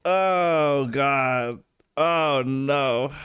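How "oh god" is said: normal talking voice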